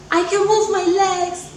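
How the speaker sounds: high, proud excited announcement; patient proudly telling nurses, excited. Retold or imitated. Proudly telling nurses she can move.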